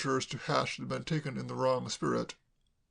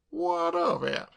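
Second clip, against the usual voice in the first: high, haughty, wounded pride; dignified indignation; much slower than usual. A narrator's reading. Soapy answering Sam's accusation with offended dignity. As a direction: draw yourself up and demand it. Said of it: "drew himself up with considerable dignity".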